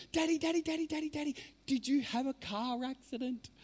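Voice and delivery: put-on high, eager child's voice calling Daddy; little daughter's voice. Little girl to her injured dad in hospital, high eager child voice. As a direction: play a four-year-old girl rushing to her dad's bed. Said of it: "she jumped up on the bed and she goes".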